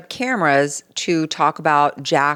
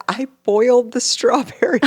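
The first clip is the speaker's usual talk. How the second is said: laughing; 12 semitones higher than usual. Real, not acted. Laughing at her own mistake.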